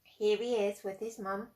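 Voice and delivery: usual speaking voice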